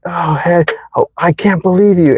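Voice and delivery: high, indignant disbelief; acted anger. Wronged person reproaching someone about to leave, acted angry disbelief. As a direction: act out a wronged lover's outrage. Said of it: "you're so angry".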